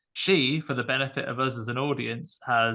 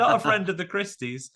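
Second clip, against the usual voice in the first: high, hammed-up protest; mock pleading; 7 semitones higher than usual. Retelling the dreamer's nightmare, mock pleading. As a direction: plead in mock distress, pitched up.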